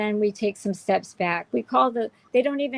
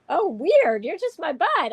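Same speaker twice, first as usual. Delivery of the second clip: retold surprise; 7 semitones higher, 3 dB louder than usual. Retold reaction to learning neighbor follows.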